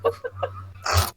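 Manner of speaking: high, loud, breathless "Oh."; flustered gasp. Acted. Blushing shopkeeper gasping at a wink. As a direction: gasp as if caught off guard. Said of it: "She blushes despite herself".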